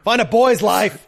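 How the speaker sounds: high, slow, hammed-up yell; mock shouted retort. Riffing on Dom's magazine comeback, mock shout. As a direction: stretch the comeback out loudly for laughs.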